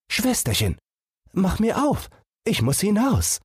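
small animal voice, longing to run out; eager little fawn. Acted. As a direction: beg like a restless young deer. Fawn wants out.

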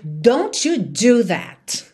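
very slow, loud stern warning; angry mother. Shown as a demonstration. Angry mother warning her son, slow and loud. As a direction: spell out each word as a threat. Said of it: "uma mãe brava dizer".

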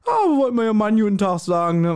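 a hint of put-on politeness at the door; sugary fake-friendly greeting, mildly played; fake polite kids.

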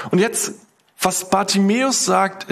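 ordinary talk, nothing marked

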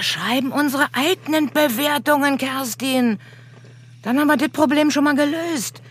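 high, fast, pleased with herself; gleeful scheming. Acted. Colleague gleefully proposing to fake reviews. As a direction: rattle it off in glee.

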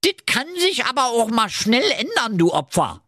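put-on high, cheeky Berlin accent threat; Berlin ninja. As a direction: sneer it like a Berlin street tough. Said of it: "der Ninja erwiderte".

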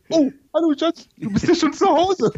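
high startled wife voice; surprised wife. Pretend. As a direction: caught off guard, laughing. Imagined wife catching him.

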